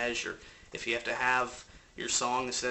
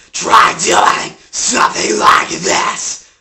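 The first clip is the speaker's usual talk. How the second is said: very loud, drawn-out distorted scream; demonstrated metal scream; 5 semitones lower, 20 dB louder, much slower than usual. Shown as a demonstration. Coach showing how to scream without straining, metal scream. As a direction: scream it slowly with harsh distortion. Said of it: "so try lowering the volume".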